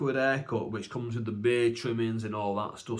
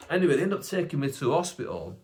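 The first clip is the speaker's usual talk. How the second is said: ordinary talk, nothing marked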